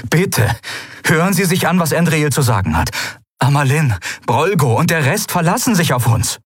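lightly voiced pleading Sephiron.

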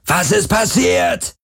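high-pitched indignant shouting; bellowed demand, pitched far up; Parker yelling. A narrator's reading. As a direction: holler for someone to answer. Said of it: "hörte er Parker brüllen".

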